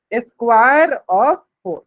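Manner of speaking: teacher models the second reading, drawn out; slow demo reading. Shown as a demonstration. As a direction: square of four, each word stretched very slowly. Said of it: "इसको ऐसे पढ़ेंगे".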